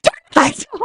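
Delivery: high, panicked cry for the lights; panicked shriek. Retold or imitated. Panicked shout in the dark.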